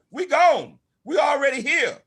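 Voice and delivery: high, loud triumphant boast; defiant shout. Retold or imitated. Telling the team what he said: high triumphant shout. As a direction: crow it out in triumph.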